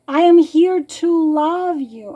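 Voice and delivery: tender letter reading, close to crying; near tears. Real, not acted. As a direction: read it as if the tears are coming. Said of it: "I'm gonna start crying".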